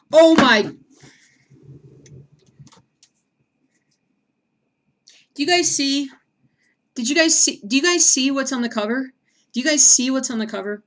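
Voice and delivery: high, loud astonished disbelief; can't believe what's on the cover; stunned viewers-look-at-this shout; shocked. Real, not acted.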